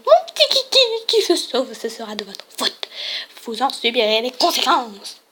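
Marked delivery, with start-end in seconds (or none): 0.1-4.2 prissy principal. Acted. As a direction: coo over the runaway pet like a fussy headmistress. Principal scolds over lost dog.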